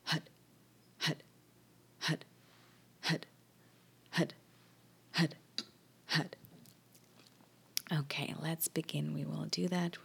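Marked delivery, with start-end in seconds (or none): 0.0-6.3 hut barked from the belly on each breath; quarterback calling cadence. Shown as a demonstration. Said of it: "you push that energy even more up".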